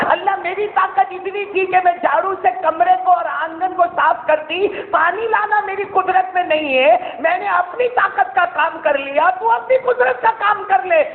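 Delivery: put-on old woman crying out to God, pitched high; aunt weeping in prayer to God; impassioned plea. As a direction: plead to Allah with rising fervour.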